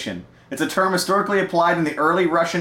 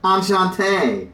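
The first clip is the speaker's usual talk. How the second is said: put-on French accent; 3 semitones higher than usual. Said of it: "She's French".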